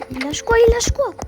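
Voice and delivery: urgent question leaping to a high shout; frantic excitement. Real, not acted. Eager to see enemy's drop. (excitation qui monte jusqu'au cri aigu)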